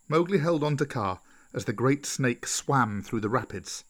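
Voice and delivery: ordinary delivery, nothing marked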